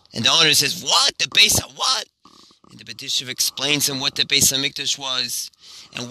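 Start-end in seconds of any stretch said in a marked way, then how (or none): 0.8-2.1 high, rising disbelief; baffled question. Acted. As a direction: stumble over an unfamiliar name, puzzled. Owner baffled by unknown name.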